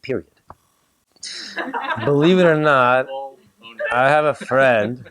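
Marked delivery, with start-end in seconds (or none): 2.0-5.1 slow, laughing, weighty retelling; playful slow-motion emphasis; mock-dramatic retake. Shown as a demonstration. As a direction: redo it like a big reveal. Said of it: "laughing restart".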